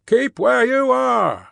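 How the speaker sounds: high, slow shouted command; drawn-out guard's warning holler; called-out order. A narrator's reading. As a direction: call high to halt him where he stands. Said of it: "the guard called to the voice in the mist".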